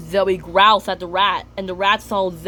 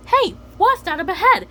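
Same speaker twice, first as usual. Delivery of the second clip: put-on high, louder kid voice; Gil excited; 11 semitones higher, 4 dB louder than usual. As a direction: point ahead excitedly. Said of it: "when Gil says".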